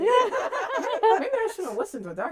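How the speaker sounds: high, slow, giggly imitation; hammed-up dawning doubt; laughing mock realization. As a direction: drag it out through laughter as mock second thoughts. Replays character's realization while laughing.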